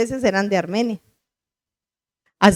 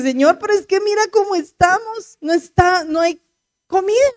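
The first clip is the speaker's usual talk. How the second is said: pleading; 7 semitones higher than usual. Retold or imitated. Pleading with God retold.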